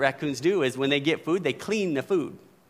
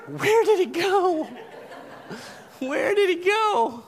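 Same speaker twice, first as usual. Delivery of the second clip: high, slow puzzled raccoon voice; a bewildered critter searching for its food; confused raccoon; 14 semitones higher, slower than usual. Retold or imitated. Pastor voicing a raccoon losing its cotton candy, high and puzzled. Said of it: "the video shows him going".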